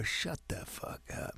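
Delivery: slow, hushed whispered threat; menacing whisper. Retold or imitated. Father staring down a Rottweiler, menacing whisper. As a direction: whisper it very slowly, imitating a menacing man.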